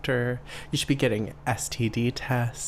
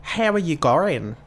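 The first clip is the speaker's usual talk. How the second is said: imitated Aussie greeting, higher pitch; playful Australian-accent greeting; 4 semitones higher, 4 dB louder than usual. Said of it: "in Australia, they say".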